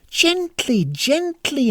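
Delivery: high, pleading gently; imploring. A narrator's reading. Princess begging Tanisan to go gently, high and pleading. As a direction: coax her to be gentle. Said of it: "implored the princess".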